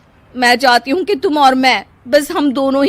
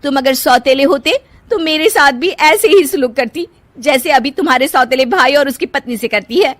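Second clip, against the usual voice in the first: resentful; 8 semitones higher, 6 dB louder than usual. Acted. Wife complaining to husband.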